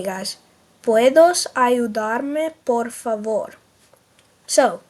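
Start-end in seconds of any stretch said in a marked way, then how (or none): 0.8-3.5 very slow, careful Spanish; drawn out for the class to echo; teacher leading a slow repeat. Shown as a demonstration. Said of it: "Say it with me".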